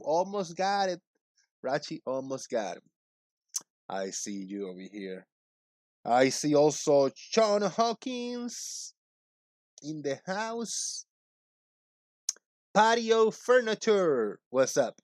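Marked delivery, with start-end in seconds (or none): 6.0-14.4 hype shout-outs. Acted. Hyping chat names slowly.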